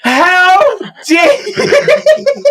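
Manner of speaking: high, drawn-out indignation breaking into laughter; laughing playful protest; mock outrage. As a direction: act offended while cracking up. Mock offence at co-host's teasing.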